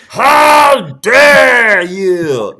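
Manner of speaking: huge, high, slow mock shout; outraged shriek. Imitating the cartoon hippo he's stuck on, outraged shriek. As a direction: bellow it in hammed-up outrage, stretching every word.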